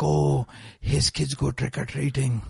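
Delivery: gossipy voice. Retold or imitated. Masjid gossip imagined.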